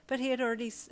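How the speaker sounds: ordinary delivery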